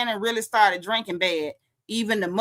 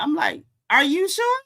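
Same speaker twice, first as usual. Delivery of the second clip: usual speaking voice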